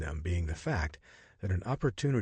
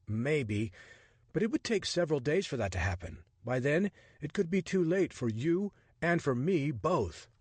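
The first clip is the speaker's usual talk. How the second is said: lightly voiced Richard, a touch higher, exasperated; a hint of a startled man grumbling; 7 semitones higher than usual.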